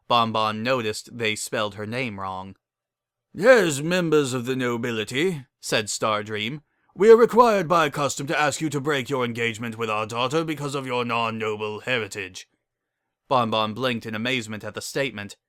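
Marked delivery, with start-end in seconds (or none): 3.4-5.5 formal noble voice. A narrator's reading. Noble decree. 6.9-12.5 formal noble voice. A narrator's reading. Noble decree.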